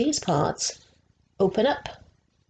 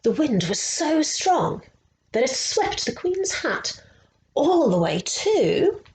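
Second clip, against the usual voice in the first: rising much higher, slowing down with suspense; dramatic storytelling; 7 semitones higher, slower than usual. A narrator's reading. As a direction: build the wind up for the kids. Dramatic build in children's story.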